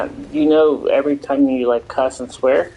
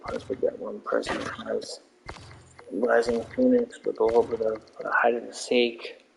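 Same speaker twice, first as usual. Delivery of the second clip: creepy voice; 8 dB quieter than usual. Shown as a demonstration. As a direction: drop into a creepy whisper-like tone. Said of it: "It does sound creepy".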